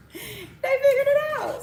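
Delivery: high-pitched, slow mock awe; mock awed voice. Praising the Bush family recipe in a lightly put-on high voice. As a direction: squeak it out like a starstruck fan.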